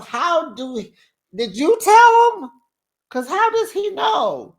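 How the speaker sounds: disbelieving, pushing for an answer. Real, not acted. Grilling Kiana about the cheating.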